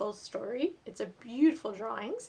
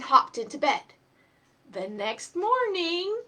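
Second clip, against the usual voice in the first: lively, higher storybook reading voice; reading to children; 6 semitones higher, 9 dB louder than usual. A narrator's reading. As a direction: read it aloud to little kids, bright and animated. Reads picture book to children.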